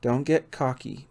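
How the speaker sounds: usual speaking voice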